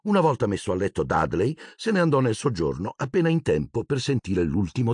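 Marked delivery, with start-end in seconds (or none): none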